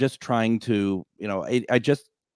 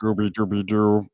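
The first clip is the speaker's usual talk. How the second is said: Scooby-Doo voice. Pretend. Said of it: "Can you do a Scooby-Doo voice?".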